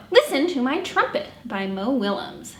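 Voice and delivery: usual speaking voice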